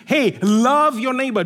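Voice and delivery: usual speaking voice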